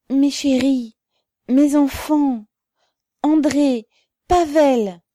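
high, loud emotional calls; crying out. A narrator's reading. Mother calls to her arrested boys, crying out. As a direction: call each one out across the crowd. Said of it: "cria-t-elle".

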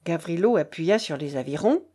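ordinary delivery, nothing marked

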